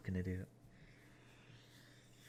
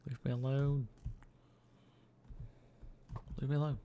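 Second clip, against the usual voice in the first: mock whiny plea; 6 semitones higher, faster than usual. Pleading with a mob.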